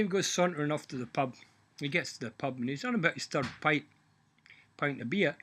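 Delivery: normal talking voice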